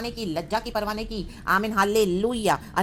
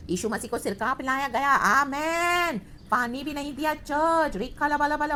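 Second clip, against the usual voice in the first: high, sustained impassioned preacher's cry; fervent preaching; 5 semitones higher than usual. Real, not acted. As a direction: proclaim it with urgent, ringing intensity. Fervent preaching on Christ's suffering. (भावपूर्ण, ऊँचे स्वर में प्रवचन)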